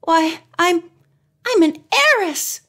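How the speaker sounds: rising high with wonder; giddy, amazed realization; awed excitement. A narrator's reading. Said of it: "in an awed voice".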